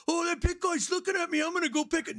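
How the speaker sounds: high, cocky little-man voice; squeaky mock tough guy. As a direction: play a tiny bar tough picking a fight. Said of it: "little guy in the bar that's like".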